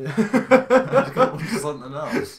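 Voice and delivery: laughing. Real, not acted. Laughing at own remark.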